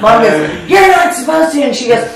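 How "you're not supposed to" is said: high, exclaiming mock mom; exasperated mom. Mom correcting daughter about plastic, exasperated. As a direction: protest it, pitched way up.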